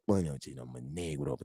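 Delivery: low, soft mumbled vendor reply; taco vendor. Retold or imitated. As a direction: mutter it like an annoyed server. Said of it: "they look at me and they go".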